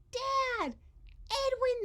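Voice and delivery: high, loud joyful call; cry out. Acted. Parents see Edwin, crying out. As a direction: call out to your lost son. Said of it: "they cried".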